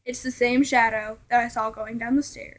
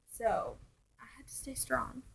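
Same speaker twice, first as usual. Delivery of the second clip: whispered under her breath; hushed confession in a scary tale; 14 dB quieter than usual. Real, not acted. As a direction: hush it like a secret. Whispered aside in ghost story.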